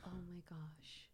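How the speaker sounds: breathless shocked whisper. Real, not acted. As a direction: hush it in disbelief. Shocked aside.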